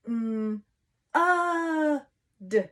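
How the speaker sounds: mm, then a high loud uh, then d; sounding out mud. Shown as a demonstration. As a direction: stretch each sound of mud apart, vowel up high. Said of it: "think about all of the sounds".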